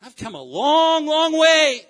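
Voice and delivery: high, loud, stretched-out exclamation; angry shout. Acted. Preacher as Jesus who came a long way, angry shout. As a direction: belt it out slowly, building in force.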